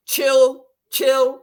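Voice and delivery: loud eager recall; calling out the name. Real, not acted. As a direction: call out the nickname. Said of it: "What was it?".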